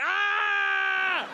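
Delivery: high mock scream of falling. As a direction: let out a short high comic scream. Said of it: "the last thing I'll think is just like".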